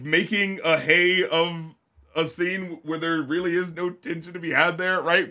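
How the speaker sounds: usual speaking voice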